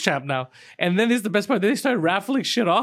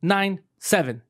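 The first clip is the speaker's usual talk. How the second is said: slow deliberate numbers; 2 semitones higher, much slower than usual. Retold or imitated. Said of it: "I'm like".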